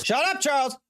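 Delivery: high, snapping shout at the game character; annoyed shout. Real, not acted. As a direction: tell Charles to shut up, sharply.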